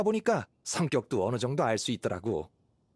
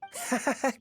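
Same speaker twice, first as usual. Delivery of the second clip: light chuckle; 10 semitones higher than usual. Acted. Hunter chuckles at Paimon.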